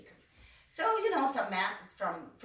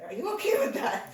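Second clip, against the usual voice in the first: laughing; 10 semitones higher, 4 dB louder than usual. Retold or imitated. Asking mom about the plane.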